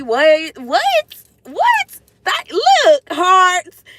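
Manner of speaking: rising squeal of surprise; shocked what, going up and up; surprised what. Real, not acted. Surprised at hearts on screen.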